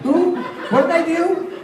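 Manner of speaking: high, startled disbelief; mock bewildered protest. Falsely accused man protesting to angry friends, high bewildered. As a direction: play it baffled and squeaky. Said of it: "I go".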